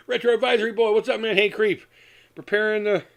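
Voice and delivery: ordinary delivery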